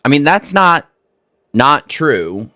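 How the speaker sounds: usual speaking voice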